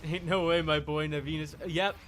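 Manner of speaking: normal talking voice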